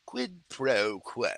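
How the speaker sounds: slow, lightly voiced menace; Hannibal Lecter quote. Quoting Hannibal to the viewers, slow and lightly sinister. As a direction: savour each word slowly. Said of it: "Hannibal Lecter".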